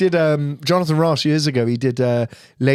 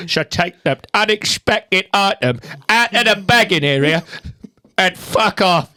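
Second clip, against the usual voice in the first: raised, building exasperation in character; Michael Caine; 5 semitones higher than usual. Acted. Arguing with a self-checkout machine, louder Caine impression. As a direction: build louder and higher to an angry finish. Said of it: "he just gets louder and louder".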